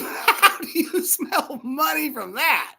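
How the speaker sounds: high, slow laughing disbelief; incredulous laughing. Retold or imitated. Son answering his father, incredulous and laughing. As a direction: imitate a son laughing at Dad's claim.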